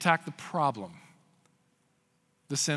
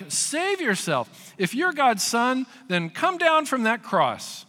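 a hint of a high, slow jeer; lightly voiced sneering taunt; mocking taunt; 8 semitones higher, 3 dB louder, slower than usual. Crowd mocking Jesus on the cross, lightly voiced high slow jeer.

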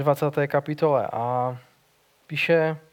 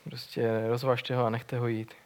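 rozvažte ho a nechte ho jít tossed off softer and lower, like a shrug; offhand, cut short; 4 semitones lower, 6 dB quieter than usual. Shown as a demonstration. Preacher mocking how briefly John ends the scene, offhand and softer. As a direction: throw the line away as if that's all there is. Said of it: "to tak jenom zkrátí a řekne".

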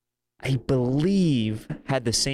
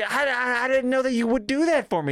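very high, put-on squeaky gratitude; mock touched surprise; 13 semitones higher than usual. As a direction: act out a high-pitched, overcome reply. Acting out own touched reply to Bobby.

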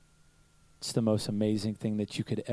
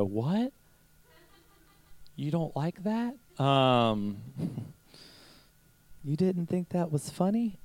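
mock offended; 5 semitones higher, slower than usual. Pastor mocks his hurt reaction to wife.

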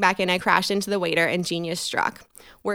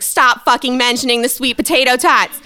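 inner scolding; 4 semitones higher, 7 dB louder than usual. Retold or imitated. Brain scolding herself.

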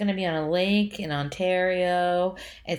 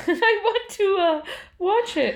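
slow, high, pleading whine; whiny; 12 semitones higher, 3 dB louder, slower than usual. Real, not acted. Whining to watch now.

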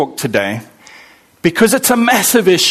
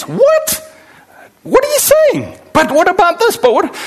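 much higher, mock-baffled protest; confused listener; 12 semitones higher than usual. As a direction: play a baffled listener who won't follow.

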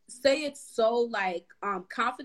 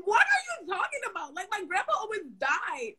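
high indignant yell at a friend; retold shout; 9 semitones higher than usual. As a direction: shout it back in outrage. Retelling heated fight with friend.

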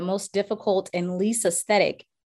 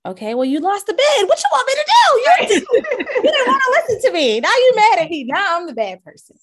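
much higher and louder, fast, laughing exasperation; exasperated retort; 13 semitones higher, 8 dB louder, faster than usual. Retold or imitated. Agent snapping back at a buyer who lost the bid, loud laughing exasperation. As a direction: throw it back at them, shrill with disbelief and a laugh.

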